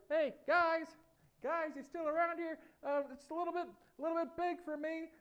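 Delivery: loud, high little voice; small David. Acted. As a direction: call out high and loud from inside a huge helmet. David lost inside huge helmet.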